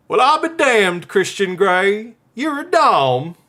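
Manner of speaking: high, loud Southern drawl, slowing right down; hammed-up Southern belle-style delivery, stretched out; Southern accent. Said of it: "The whole thing you want done in a southern accent?".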